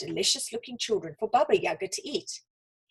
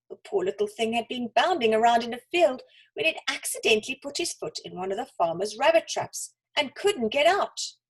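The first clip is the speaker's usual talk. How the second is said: ordinary delivery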